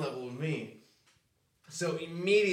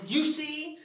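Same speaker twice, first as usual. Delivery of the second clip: exasperated mom; 10 semitones higher than usual. Retold or imitated. Mom proving nobody's outside.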